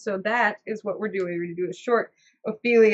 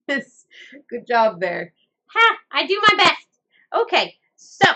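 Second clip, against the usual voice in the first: puppet voice; 5 semitones higher, 7 dB louder than usual. Acted. Puppet jokes about sneeze.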